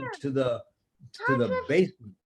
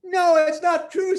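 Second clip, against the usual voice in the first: shout; 14 semitones higher, 7 dB louder than usual. Acted. Tina protests in story.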